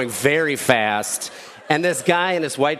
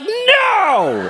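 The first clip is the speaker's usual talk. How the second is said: very high, loud, long wail; mock outraged refusal; 5 dB louder than usual. Driver refusing stranger's help, outraged yell. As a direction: holler it out, stretched and falling.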